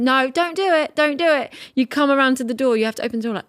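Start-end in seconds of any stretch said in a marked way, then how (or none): 0.0-1.5 mock plea. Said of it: "I'm looking at you going".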